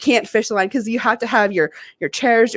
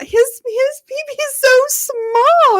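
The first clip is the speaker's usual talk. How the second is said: put-on high, slow kid voice; imitated teasing little boy; 14 semitones higher, 4 dB louder, much slower than usual. Parent quotes small son in the bath, high teasing child voice. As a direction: draw it out like a tickled toddler being mimicked.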